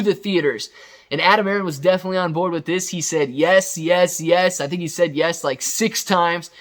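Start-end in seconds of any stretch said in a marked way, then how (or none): none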